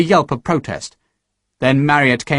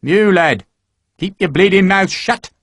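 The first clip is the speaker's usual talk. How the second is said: kindly gruff; 6 semitones higher than usual. Acted. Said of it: "He said it in a kindly enough way".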